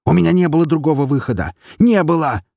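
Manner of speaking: high, fast desperate cry; frantic outburst. Acted. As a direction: blurt it out, panicking.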